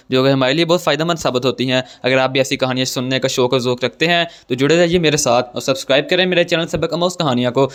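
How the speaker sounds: loud, upbeat announcer read of the channel intro; channel intro announcement. Acted. Host greets viewers and introduces the story channel, announcer voice. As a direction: greet the listeners like a host opening the show.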